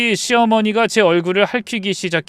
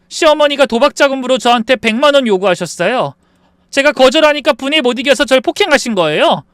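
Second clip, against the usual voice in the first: accusing testimony; 9 semitones higher, 7 dB louder than usual. Acted. Daughter-in-law testifies against her.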